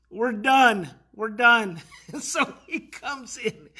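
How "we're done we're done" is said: louder, short firm statement; retold firm order. Telling the cussing kids to stop, firm. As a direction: put your foot down, lightly acted.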